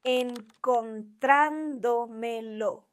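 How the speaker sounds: Encontrándomelo drawn out slowly, stressed on TRÁN; slow syllable-by-syllable stress. Shown as a demonstration. Teacher giving the quiz answer, slow Encontrándomelo stressed on trán. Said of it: "This one is a sobresdrújula".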